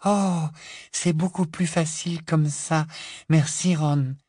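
put-on high, airy girl's voice; Luna, serene and grateful. A narrator's reading. Luna thanking Ron for the light, serene gratitude.

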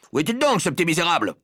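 exasperated father's outburst; irritated demand. Acted. Father angrily demands his son.

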